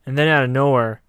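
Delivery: normal talking voice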